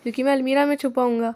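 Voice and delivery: normal talking voice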